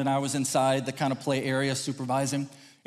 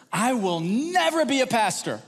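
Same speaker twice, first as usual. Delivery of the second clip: higher, slow mock declaration; emphatic vow; 7 semitones higher than usual. Preacher quoting his younger self's vow, emphatic. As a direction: draw out a grand refusal.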